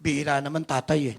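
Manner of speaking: ordinary delivery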